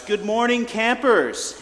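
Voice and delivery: high, loud wake-up holler; imitating an over-jolly DJ's yell; radio DJ shout. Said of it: "he shouts".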